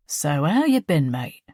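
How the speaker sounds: put-on light Cockney accent, falsely friendly; lightly voiced Cockney crime boss, matey on the surface; Cockney gangster. A narrator's reading. Crime boss chats to Fiach in the car in a light Cockney accent. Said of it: "His accent was pure Cockney".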